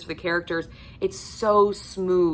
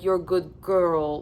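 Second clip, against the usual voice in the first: imitating narrator's deep voice; slower than usual. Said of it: "It is so deep, it is so guttural, it is so raspy".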